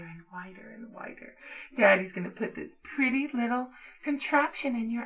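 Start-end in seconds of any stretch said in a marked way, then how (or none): 0.0-1.4 whisper. Acted. Dominant to partner, a hushed whisper. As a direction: breathe it as a menacing whisper. Domme repeats line, then whispers.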